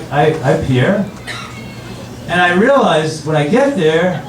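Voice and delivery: normal talking voice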